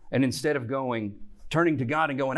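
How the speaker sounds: normal talking voice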